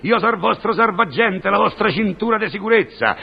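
high, pompous self-proclamation; grandiose mystic. Acted. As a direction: proclaim yourself a great seer. (tono solenne e pomposo)

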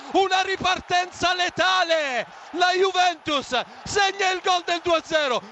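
drawn-out ecstatic name shout; breathless italian goal commentary. Real, not acted. Second Juventus goal call. (urlo del gol in telecronaca)

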